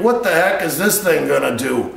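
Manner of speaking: ordinary delivery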